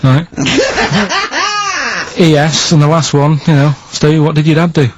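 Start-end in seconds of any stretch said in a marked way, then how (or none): 0.3-2.1 squeaky high giggle; a short high-pitched titter. Real, not acted. Laughing at his own clue.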